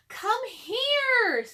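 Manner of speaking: put-on high, loud girl's call. A narrator's reading. Annie calling Jack to the oak tree, high loud call. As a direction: call him over eagerly. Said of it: "called Annie".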